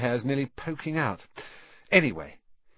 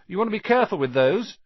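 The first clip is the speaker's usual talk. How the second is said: higher, louder, quick warning; quick-fire boyish warning; 10 semitones higher, 6 dB louder, faster than usual. A narrator's reading. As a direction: caution a friend urgently. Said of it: "Ron warned Harry".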